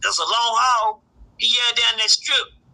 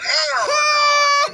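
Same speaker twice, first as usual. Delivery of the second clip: shocked outcry; 3 dB louder than usual. Retold or imitated. Shocked to find son in jail.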